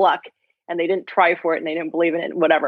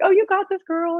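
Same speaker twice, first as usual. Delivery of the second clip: imitating the upbeat coach, much higher; pep-talk coach cheering her on, higher; confident coach; 12 semitones higher than usual. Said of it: "she's super confident and just like".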